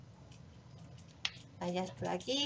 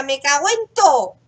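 louder, exasperated swear; frustrated curse; 3 semitones higher, 11 dB louder than usual. Real, not acted. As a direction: blurt it out, annoyed. Cursing at the game in frustration.

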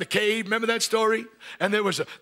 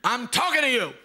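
higher-pitched mock scolding; scolding mother; 5 semitones higher than usual. Preacher imitating his mother scolding him, exasperated. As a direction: imitate a mother demanding attention. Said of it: "And she'd say".

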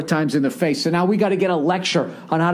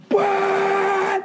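train horn imitation. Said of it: "and then".

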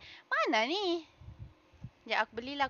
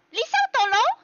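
high, loud mock catchphrase; 8 semitones higher, 6 dB louder than usual. Streamer parroting a game voice line, high loud mimicry.

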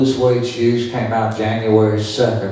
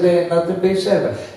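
high, quick dismissal retelling dad; tickled disbelief; 9 semitones higher, much faster than usual. Retold or imitated. Dad dismissing the shoe song, tickled disbelief. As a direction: brush it off fast, amused and high-pitched. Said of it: "my dad got tickled at him".

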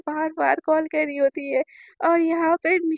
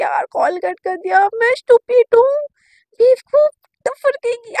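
much higher and louder, cursing herself; loud self-scolding; 6 semitones higher, 7 dB louder than usual. Retold or imitated. Girl calling herself stupid after the call ends, shouting. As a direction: yell at yourself for being stupid.

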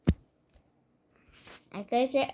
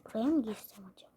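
fast whisper; sneaky whisper; 5 semitones lower, 9 dB quieter, much faster than usual. Acted. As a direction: whisper it quickly like you're sneaking in. Sneaking into a house.

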